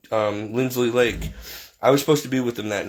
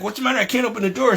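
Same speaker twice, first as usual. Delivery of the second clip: alarmed; 8 semitones higher, much faster than usual. Retold or imitated. Father blocked at bathroom door.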